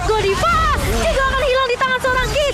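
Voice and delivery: high, fast hyped teamfight call; fighting game announcer. Acted. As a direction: shout the action like a caster.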